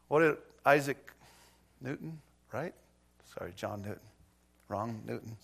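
ordinary talk

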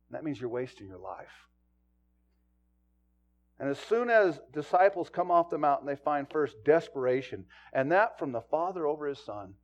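ordinary talk

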